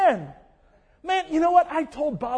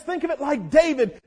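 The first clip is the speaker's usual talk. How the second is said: ordinary talk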